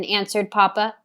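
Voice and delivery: ordinary delivery, nothing marked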